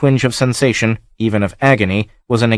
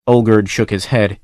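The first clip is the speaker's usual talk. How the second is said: ordinary delivery, nothing marked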